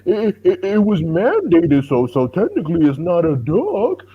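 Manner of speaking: high, drawn-out mocking voice; slow, whiny-high parody of a lame excuse; mock excuse-making. Mimicking fighter's camp excusing a ducked fight, mock excuse-making. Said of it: "so they can be like".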